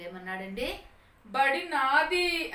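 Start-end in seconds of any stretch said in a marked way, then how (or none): 1.3-2.5 put-on drawn-out, higher, loud child's shout; acted stubborn shout, stretched out; loud cry. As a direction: yell it slowly as an insistent boy. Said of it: "అని గట్టిగా కేకేశాడు".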